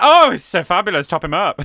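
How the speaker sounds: loud, high, delighted gush; gushing excited. Retold or imitated. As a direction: rave about it like smitten crew. Cabin crew gushing over glass.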